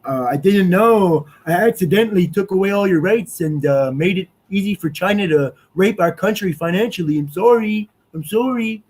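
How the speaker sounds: mock official apologizing; mock apology. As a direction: sneer it like a fake apology. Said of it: "You don't get to say".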